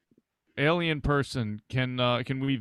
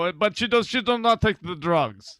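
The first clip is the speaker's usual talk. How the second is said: put-on Russian accent; 4 semitones higher, 3 dB louder than usual. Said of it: "You said you come from Georgia".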